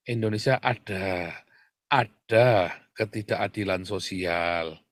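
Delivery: low, drawn-out imitation of cautious wording; slow, mock-measured understatement; mock euphemism. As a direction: mimic someone carefully avoiding the real word. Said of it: "Ngomongnya begitu kan?".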